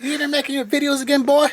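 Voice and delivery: high scolding mom voice; yelling mom. Retold or imitated. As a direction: scold him like an angry mom. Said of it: "his mom is gonna yell at him".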